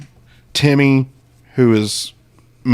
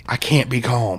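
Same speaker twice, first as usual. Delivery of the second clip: whispered protest. Pretend. Mock upset, whispered back.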